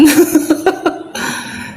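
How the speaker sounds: breathless, high wheezing giggle; helpless laughter at the guard; airy high laugh. Real, not acted.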